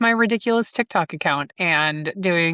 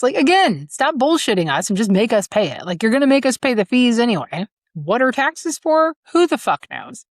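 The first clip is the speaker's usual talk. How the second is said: exasperated rant; 6 semitones higher, 3 dB louder than usual. Real, not acted. Ranting about school fees.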